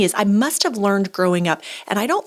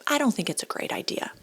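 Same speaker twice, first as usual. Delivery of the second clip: whispered, barely voiced; a timid whispered suggestion; soft whisper; 3 semitones higher, 8 dB quieter than usual. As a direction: say it in a hushed whisper. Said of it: "I just say".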